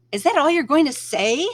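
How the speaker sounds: angry retort. A narrator's reading. Narrator snaps angrily at the calm rabbit. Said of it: "I asked".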